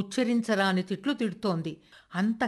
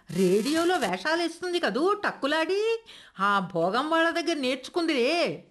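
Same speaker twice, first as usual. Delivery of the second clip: shrieking grandmother; 6 semitones higher than usual. A narrator's reading. Grandmother cursing Aruna before the family, high and furious. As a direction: rail at her as a disgrace. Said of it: "గట్టిగా అరుస్తున్నది".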